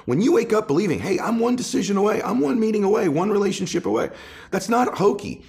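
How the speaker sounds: high, loud, driving sermon voice; rally the room with every line; preaching at full force. Acted. Speaker urging listeners on podcast, loud preaching.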